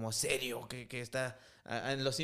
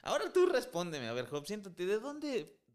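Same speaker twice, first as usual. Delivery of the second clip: God taunting Job with sarcasm; sarcastic God; 6 semitones higher than usual. Retold or imitated. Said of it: "Eso es sarcasmo". (Dios hablándole a Job con sarcasmo burlón)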